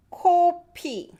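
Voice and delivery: 코피 said high with a strong puff on 코; 코피 with aspirated k. Shown as a demonstration. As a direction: pop the 코 in 코피 with air. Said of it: "코, nose".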